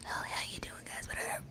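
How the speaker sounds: hushed, very soft imitation; barely-voiced murmur into a listener's ear; mock ear-whisper. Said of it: "When I talk in you guys' ear".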